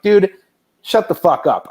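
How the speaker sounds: half-joking snap, the opening word pitched high and loud; a retold brush-off, punchy but played for laughs; mock angry retort. Creator retelling how he told off a know-it-all, mock angry. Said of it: "And I'm like".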